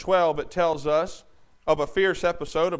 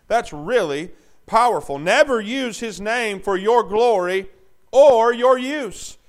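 loud, raised preacher's voice; full-force preaching; 6 semitones higher, 10 dB louder than usual. Real, not acted. Preacher telling the congregation God's name is holy, forceful. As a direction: drive the warning home loudly.

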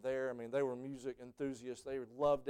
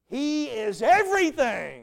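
much higher and louder, slow and stretched; soaring proclamation; 12 semitones higher, 14 dB louder, slower than usual. Pretend. Preacher voicing the redeemed before Jesus' throne, soaring cry. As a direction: cry it out to the whole congregation. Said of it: "we're gonna say".

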